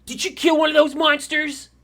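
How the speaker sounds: put-on high, loud kid voice; protesting child. Orc child protests to the lady, high loud voice. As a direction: protest loudly like an indignant child. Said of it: "And he just looks at her, he's like".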